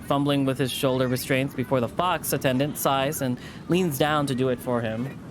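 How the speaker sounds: usual speaking voice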